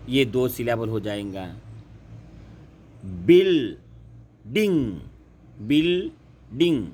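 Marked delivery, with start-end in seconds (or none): 3.1-5.0 building very slow, a long gap between BIL and DING; syllable by syllable. Shown as a demonstration. Teacher counting syllables for students, building split slowly in two. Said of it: "दो शब्द हो गए". 5.6-6.9 building slow and higher, split BIL, DING; syllable by syllable. Shown as a demonstration. Teacher repeating for students, building split into two beats. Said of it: "दो शब्द हो गए".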